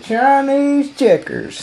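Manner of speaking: very high, slow odd voice; put-on voice. Customer announcing his big find. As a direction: stretch it out in a funny voice. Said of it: "I said".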